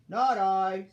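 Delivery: very loud, blunt refusal; reindeer refusing. A narrator's reading. Reindeer refusing to help the elf, very loud. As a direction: belt out a reindeer's no.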